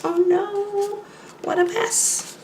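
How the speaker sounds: high, soft oh no; high dismayed aside. Acted. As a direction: squeak out the dismay. Aside looking at picture.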